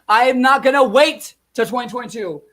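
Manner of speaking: furious shout. Real, not acted. Vlogger vents to his viewers that his vote doesn't count, shouting in fury. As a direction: hammer each word out in outrage.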